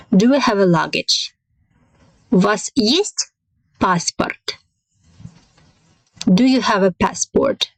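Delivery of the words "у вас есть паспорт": sharp rise on "есть", then drop; rising question intonation. Shown as a demonstration. Teacher demonstrating a Russian question to learners, pitch jumping up on "есть". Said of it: "here we have a rising intonation".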